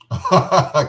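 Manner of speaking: loud burst of laughter; real hearty laugh; big laugh. Real, not acted. Laughing at crying guest.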